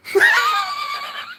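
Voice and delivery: very high squeal of laughter; shrieking laugh. Real, not acted. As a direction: burst into a piercing cackle. Cracks up at own story.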